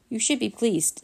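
ordinary delivery, nothing marked

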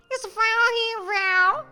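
high, drawn-out wail; hysterical yell. A narrator's reading. Mother climbing out of the coffin, hysterical yell. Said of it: "minha mãe berrou histérica".

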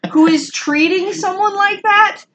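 high, insistent soapbox plea; worked-up public-service announcement; soapbox rant. Real, not acted. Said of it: "I'm off my soapbox".

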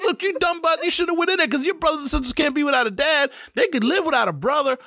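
put-on high, half-joking scold at the kid; hammed-up scolding of a reckless kid; mock scolding self. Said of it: "I'm like".

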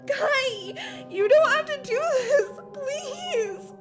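sobbing pleas for mercy; crying, high-pitched appeals breaking down; tearful begging. Acted. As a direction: beg your attacker through tears. Victim begs tearfully for mercy.

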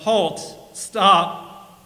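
storyteller's half yell; mild yell. A narrator's reading. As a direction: call out to a runaway donkey. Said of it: "the man yelled".

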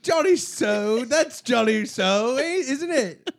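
slow, high British drawl; British accent. Pretend. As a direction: savour the name in a British accent. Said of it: "Oh, I'm going British".

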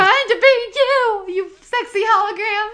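high, loud, wailing imitation of mom; mock angry snapping. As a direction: snap at the hologram, hammed up. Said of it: "snaps at the".

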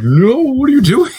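overplayed hero losing it at a friend; outraged Captain America. Steve coming back, scolding.

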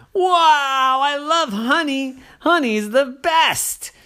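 high and loud with delight; excited girl. Acted. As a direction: gush over the honey. Ollie finds the honey.

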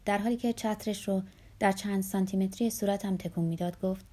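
ordinary delivery

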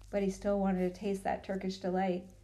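plain reading voice

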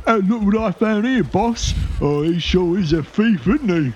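high sneering sidekick; gloating henchman. Acted. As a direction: crow over the loot to the boss. Henchman gloats over found loot.

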